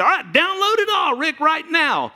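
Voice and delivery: high, loud mock command; hammed-up Jesus giving orders; Jesus voiced. Preacher voices Jesus dumping it all at once, high and loud. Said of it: "and say".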